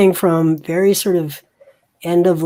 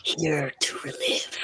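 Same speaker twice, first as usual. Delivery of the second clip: very soft, voiceless whisper; low whisper; 8 dB quieter than usual. Acted. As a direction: whisper it under your breath. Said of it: "you hear a whisper, very low".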